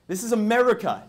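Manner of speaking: loud, high proclamation; hammed-up rallying cry; mock patriotic outrage. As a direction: declare it like an indignant patriot. Mock patriotic rant against tyrants.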